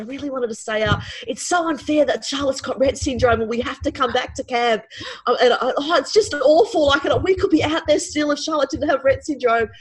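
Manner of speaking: high, loud, wailing protest; sob-shout it like the night is ruined; hysterical teen outburst. Retold or imitated. Teen daughter ranting in the tent at bedtime, high hysterical wail. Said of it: "Georgia was completely hysterical".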